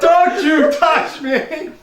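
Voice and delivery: high, loud, very slow mock outrage; laughing hammed-up protest; mock indignation. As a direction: drag it out like an offended diva.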